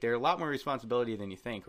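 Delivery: usual speaking voice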